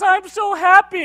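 high, loud, drawn-out blubbering; hammed-up tearful girlfriend; mock happy crying. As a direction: wail it through pretend happy tears. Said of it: "I see crying right now".

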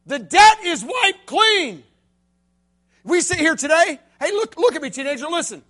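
fiery youth-pastor preaching; full-force preaching. Acted. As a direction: drive the story home to the crowd.

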